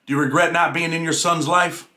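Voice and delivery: normal talking voice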